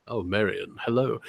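low, soft, smooth British voice; hushed, deep British smoothie. Acted. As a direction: croon it like a suave British charmer. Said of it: "when I do the voice".

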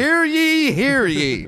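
loud town crier call; renaissance fair olde speech. Retold or imitated. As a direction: cry it out like a herald in the square. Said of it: "heralds and town criers and shit".

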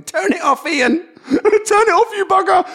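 high-pitched squealing protest; laughing shout. A narrator's reading. Mum running from the cold sea to Ian filming, laughing shout. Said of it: "ran back laughing, shouting".

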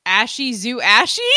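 "Ashy zoo ashy" with English flat a and ee endings, rising; low, questioning "Ashy zoo ashy"; mangled German. Retold or imitated. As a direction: ask "Ashy zoo ashy" as badly anglicised German. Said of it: "made it German".

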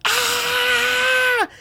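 high-pitched imitated woman screaming; sustained high mock scream; woman's scream. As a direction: shriek like the failing car, very high. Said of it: "sound like a woman screaming".

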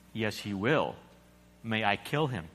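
low, soft, steady angel voice; a quiet, unhurried angel; calm angel. A narrator's reading. Angel insists.

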